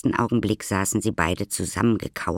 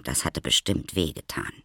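ordinary delivery, nothing marked

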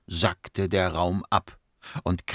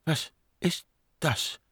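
slow, high frightened whisper; hushed, halting question; 8 semitones higher, 6 dB quieter than usual. Acted. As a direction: whisper each word separately. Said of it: "flüsterte eine Frau".

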